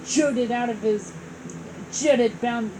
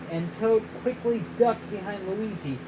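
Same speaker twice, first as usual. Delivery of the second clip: plain reading voice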